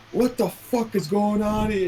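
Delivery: high, slow mock outrage; angry office swan. Swan yelling at the boss in the office, high slow mock outrage. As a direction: squawk it like an outraged swan at work.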